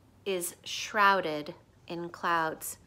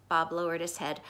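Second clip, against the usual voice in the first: ordinary delivery, nothing marked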